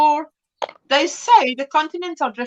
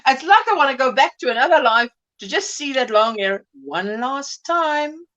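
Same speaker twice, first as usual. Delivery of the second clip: ordinary delivery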